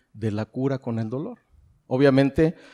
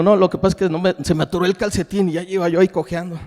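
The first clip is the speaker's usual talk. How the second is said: louder, fast, defensive excuse; proud excuse; 4 semitones higher, 6 dB louder, faster than usual. Retold or imitated. Husband brushing off his wife's concern with a quick, louder, defensive excuse. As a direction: brush it off so she won't see you hurt.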